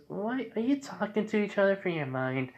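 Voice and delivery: put-on high little-girl question; Eri. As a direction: ask it puzzled, in a small high voice. Little girl puzzled by them.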